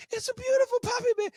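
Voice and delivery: very high, gushing wife imitation; mock wife voice. Imitating the wife pleading to her husband, very high and gushing. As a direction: squeal it like a wife in love with a puppy.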